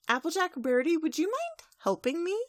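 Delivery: high, lilting plea to friends; polite request. Acted. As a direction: ask a favor in a sweet high voice. Twilight sweetly asks friends for help.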